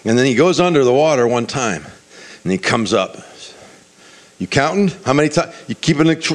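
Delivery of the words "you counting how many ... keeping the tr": higher, acted exasperated questioning; frustrated. Naaman to his servant on the shore, frustrated. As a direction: fire off questions in mild mock frustration. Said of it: "And he's frustrated".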